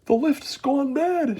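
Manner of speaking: high, loud, slow frightened cry; alarmed shout. A narrator's reading. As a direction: shout in panic. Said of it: "shouted Grandpa Joe".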